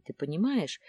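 usual speaking voice

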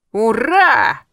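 high, loud, drawn-out hurrah; triumphant cheer. A narrator's reading. Friend celebrates her successful séance, cheering triumphantly. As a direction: cheer like you have just won. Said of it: "победно подняла руки вверх".

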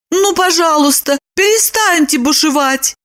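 high-pitched imploring woman's voice; pleading. A narrator's reading. Lipochka begs Roman to calm down, pleading. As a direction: beg him to stop in a high voice. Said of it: "взмолилась она".

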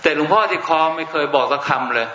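ordinary delivery

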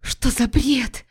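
breathless whisper, slow; stunned wife whispering; barely audible. Acted. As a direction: whisper it in shock. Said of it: "едва слышно".

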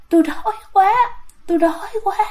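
high moan carried on the breath; groaning ghost. Retold or imitated. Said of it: "mà trong cái tiếng thở đó".

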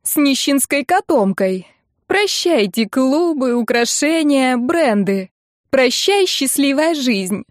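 high, drawn-out farewell; wailing lament. A narrator's reading. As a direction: mourn each lost luxury theatrically. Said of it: "продолжала Ирка на одной ноте". (протяжно причитает)

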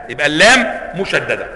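ordinary talk

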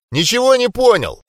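high, loud yell over wind; yelling back. Acted. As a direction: shout back while running. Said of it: "проорал я в ответ".

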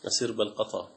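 normal talking voice

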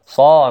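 صا with a full, dark emphatic s; heavy saad. Shown as a demonstration. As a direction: sound out a thick ص in صا. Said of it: "وهذا من الخطأ". (نطق صا بصاد مفخمة)

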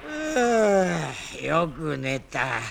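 low, slow just-woken drawl; 寝起きのだるそうな低い声; sleepy waking groan. Acted. (起きたばかりの悪魔が眠そうにぼやく)

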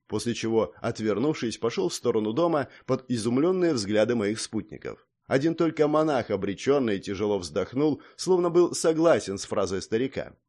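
ordinary delivery, nothing marked

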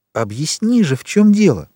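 high, urgent demand to explain; insistent plea. A narrator's reading. Granddaughter begging grandfather to explain, insistent.

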